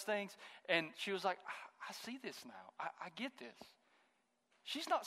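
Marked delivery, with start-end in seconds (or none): 1.5-3.6 softly retold student's realisation; quiet realisation. A student tells the preacher she gets it, quiet realisation.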